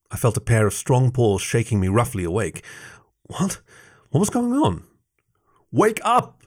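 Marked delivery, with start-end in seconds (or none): 3.2-4.8 high, startled question on waking; groggy confusion. A narrator's reading. Narrator roused from deep sleep. 5.7-6.4 high-pitched urgent call, volume held back; almost shout it to rouse a sleeper; near shout. A narrator's reading. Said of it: "Jason almost shouted".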